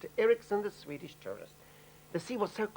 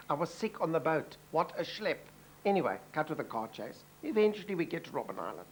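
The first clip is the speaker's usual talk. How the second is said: normal talking voice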